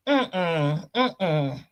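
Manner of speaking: ordinary talk, nothing marked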